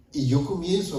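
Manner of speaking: normal talking voice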